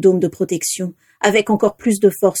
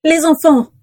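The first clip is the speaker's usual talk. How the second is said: higher, louder short-of-breath urgency; breathless; 8 semitones higher, 4 dB louder than usual. A narrator's reading. Narcissa grabs Eleanor's arm, breathless and urgent. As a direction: gasp it out in alarm. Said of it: "dit-elle le souffle court".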